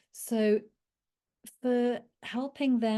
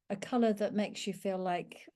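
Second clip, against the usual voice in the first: normal talking voice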